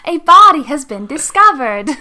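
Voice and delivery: high, very loud mock proclamation; hammed-up murder-mystery announcer; mock dramatic announcement. Mock dramatic discovery announcement as intro.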